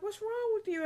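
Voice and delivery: high quizzical husband voice; asking what's wrong, puzzled. Retold or imitated. Husband quoted.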